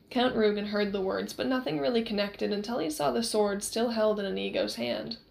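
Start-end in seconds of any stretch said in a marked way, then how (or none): none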